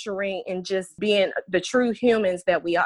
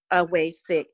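usual speaking voice

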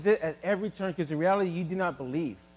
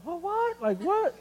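astonished; 10 semitones higher, slower than usual. Retold or imitated. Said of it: "I was like".

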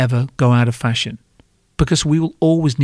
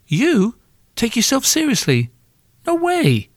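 much higher, rising fake disbelief; squeaky denial that rises at the end; lying high voice; 11 semitones higher than usual. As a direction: friend fibbing, voice climbing high. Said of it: "voice go a little higher".

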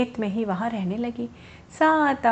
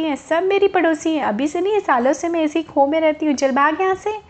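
furious bird; 6 semitones higher than usual. Acted. Bird shouting at the rabbit who took her hollow, high furious yell. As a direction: shriek it out, defending her home. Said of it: "तो चकोर को बड़ा गुस्सा आया".

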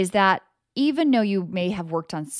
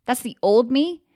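sassy, loud, raised-pitch clapback; defiant comeback; 6 semitones higher, 5 dB louder, slower than usual. Pretend. To her own old belief, a defiant sassy refusal. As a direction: slam the door on an old belief with attitude. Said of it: "That's when you choose to say".

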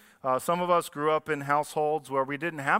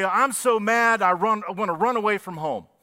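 mock angry child; 5 semitones higher, 5 dB louder than usual. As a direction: play the furious kid, stretched out and loud. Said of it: "you say".

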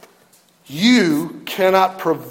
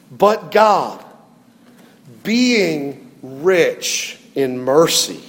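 solemn scripture reading; 5 semitones lower than usual. Acted.

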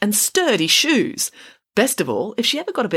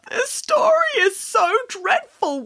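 high, drawn-out tearful wail; slower than usual. A narrator's reading. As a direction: wail it melodramatically, pitched way up. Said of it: "wailed Boris".

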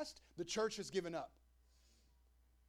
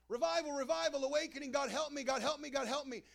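higher, louder desperate plea; crying out; 6 semitones higher, 4 dB louder than usual. Retold or imitated. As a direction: cry out to God for help. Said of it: "we will cry out".

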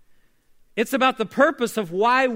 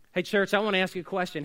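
ordinary talk

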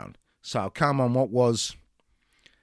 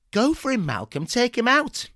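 higher, egging him on; urging; 10 semitones higher, 4 dB louder than usual. Acted. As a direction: egg him on to attack. Urging attack.

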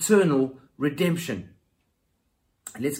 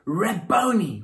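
cries out; 5 semitones higher, 7 dB louder, slower than usual. Pretend. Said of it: "she cries out".

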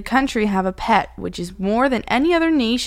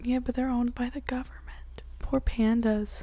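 sad whisper; 3 semitones higher, 7 dB quieter than usual. Real, not acted. Sad about pandas on loan.

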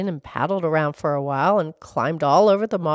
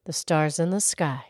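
ordinary delivery

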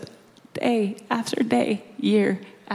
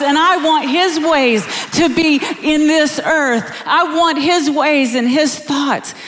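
raised, driving preacher voice; impassioned preaching; 7 semitones higher, 7 dB louder than usual. Acted. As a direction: preach it higher and more forcefully. Preaches with rising force.

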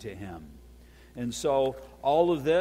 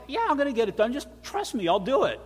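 much higher-pitched mock reassurance; acted breezy assurance; 9 semitones higher, 3 dB louder, faster than usual. Electrician reassuring a doubting client, acted high-pitched assurance. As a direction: brush off the worry, pitched way up.

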